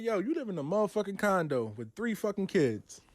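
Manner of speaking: ordinary delivery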